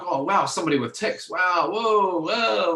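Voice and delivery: high, loud, drawn-out wows; mock excited doctor. Patient mimics doctor thrilled by his tics, mock excited. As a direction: gush in over-the-top imitation of his excitement. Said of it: "he got excited".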